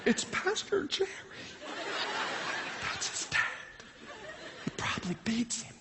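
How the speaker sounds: high, hushed mock gossip; imitated gossiping thoughts. Parents silently judging the boy's pastor dad, hushed mock gossip. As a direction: think it aloud in a scandalized hush. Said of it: "You know, they're thinking".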